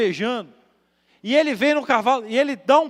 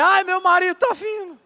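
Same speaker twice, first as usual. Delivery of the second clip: assustada; 10 semitones higher, 6 dB louder than usual. Retold or imitated. Wife sees violent husband coming.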